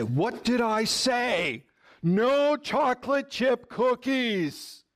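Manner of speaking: a hint of a firm parent, slow; mock stern parent. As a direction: lay down the law, slowly. Parent scolds child in store.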